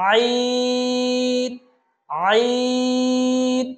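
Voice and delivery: আইন from mid-throat, long level vowel; mid-throat articulation. Shown as a demonstration. Teacher models the throat letter, আইন drawn out. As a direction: chant আইন from the middle of the throat. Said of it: "কণ্ঠনালীর মধ্যখান থেকে".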